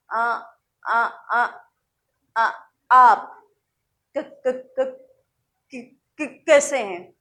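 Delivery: stuck on first sounds, repeating them with gaps; stuttering demo. Shown as a demonstration. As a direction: demonstrate a halting stutter. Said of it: "जैसे रुक रुक कर बोलते हैं".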